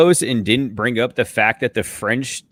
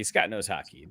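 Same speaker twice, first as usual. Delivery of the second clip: much softer, lower, under the breath; muttered aside; 5 semitones lower, 12 dB quieter than usual. Acted. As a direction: drop it away, muttering to yourself. Mocking repeat of a teammate.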